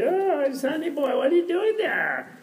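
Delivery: put-on high, drawn-out cooing old-story voice; cleaning lady. Cleaning lady surprises the reading scholar, high drawn-out voice. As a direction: imitate a cleaning lady in a slow, high singsong.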